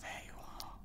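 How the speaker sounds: soft bedtime whisper. Acted. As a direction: whisper it like lights out.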